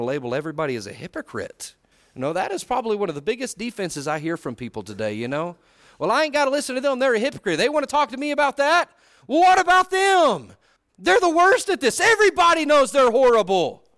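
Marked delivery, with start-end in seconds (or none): 6.0-13.8 high, loud mock outrage; indignant defensiveness. People refusing correction from hypocrites, indignant mock rant. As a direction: build into an exaggerated accusing shout.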